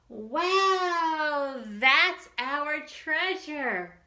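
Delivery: loud, slow awestruck cry; awed wow. Acted. Friends seeing the waterfall, crying out in slow awe. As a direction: let the wonder pour out slowly.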